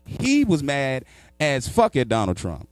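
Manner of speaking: ordinary talk, nothing marked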